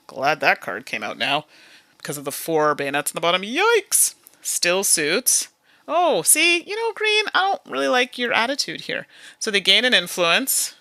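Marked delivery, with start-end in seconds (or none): 3.4-4.1 high squeaky yelp of surprise; startled jump up in pitch; yikes. Real, not acted. Gamer reacting to a bad card draw, startled yelp. 5.9-9.1 high, sing-song telling-off; mock scolding. Gamer playfully scolding the green opponent, mock stern.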